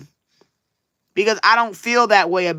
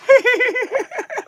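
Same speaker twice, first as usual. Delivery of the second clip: high rising giggle; a quick high giggle; high amused giggle. Real, not acted. Laughs about Charlie.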